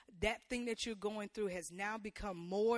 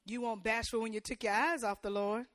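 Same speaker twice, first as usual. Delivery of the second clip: usual speaking voice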